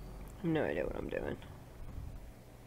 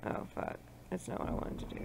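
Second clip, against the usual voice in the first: muttered dismay; 2 semitones lower, 16 dB quieter, much faster than usual. Real, not acted. Mistake, muttered.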